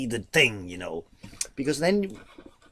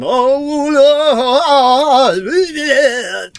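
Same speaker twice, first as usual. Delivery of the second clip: mock showy wail; 12 semitones higher, 13 dB louder than usual. Mocks showy singers' top voice.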